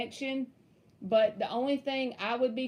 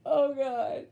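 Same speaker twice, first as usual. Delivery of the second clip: trying to recover from giggles; helplessly amused; still laughing; 3 semitones higher than usual. Real, not acted. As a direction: exclaim it out of a laughing fit. Laughing fit goes on.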